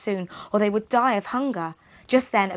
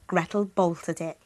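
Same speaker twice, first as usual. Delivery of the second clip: ordinary delivery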